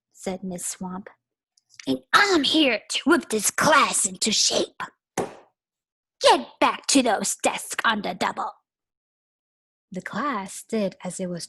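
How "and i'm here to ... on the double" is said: loud, barking commands; strict teacher. Acted. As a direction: bark it like a drill sergeant. Voices Miss Swamp.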